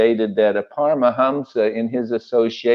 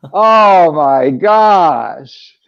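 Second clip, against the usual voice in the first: laughs through a slow, higher, louder oh my gosh; real joyful laugh while speaking; 5 semitones higher, 7 dB louder, much slower than usual. Real, not acted. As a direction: overcome with happy laughter. Overjoyed memory, laughing aloud.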